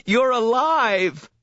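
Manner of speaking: high, slow cry of joy breaking out; a brother's disbelieving joy, pitched high and slow; overwhelmed relief. A narrator's reading. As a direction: let the relief burst out of you. Brother finds sister alive.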